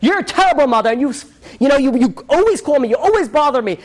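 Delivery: high-pitched angry tirade, acted out; retold screaming. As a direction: imitate a son raging at his mother. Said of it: "he starts screaming at her in public".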